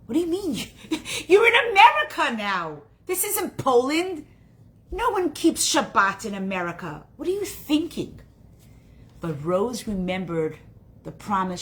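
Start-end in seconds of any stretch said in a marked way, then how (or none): 0.0-8.2 high, loud disbelieving protest; exasperated scolding. Acted. Relatives scold Rose over keeping Shabbat, exasperated. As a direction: scold a naive newcomer in mock outrage.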